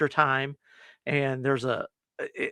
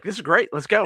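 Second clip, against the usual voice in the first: eager; 5 semitones higher, 5 dB louder than usual. Retold or imitated. Brad eager to leave.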